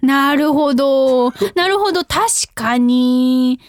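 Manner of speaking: drawn-out, pitch-swooping operator sympathy; hammed-up customer-service listening voice; call-centre operator. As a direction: play an effusively agreeing call-centre agent. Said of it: "コールセンター。".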